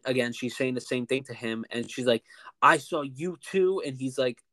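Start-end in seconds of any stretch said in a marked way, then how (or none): 2.6-3.8 slow, higher, louder imitated accusation; drawn-out mock accusation; accusing customer. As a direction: mimic a customer pointing the blame. Customer accuses the manager, retold.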